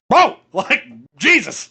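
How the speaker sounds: high, loud startled outburst; shocked exclamations. Retold or imitated. Watching the scene with his wife, loud shocked yelp. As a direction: blurt it out as if you just saw it. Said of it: "my wife and I both went".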